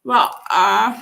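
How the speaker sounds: ordinary talk, nothing marked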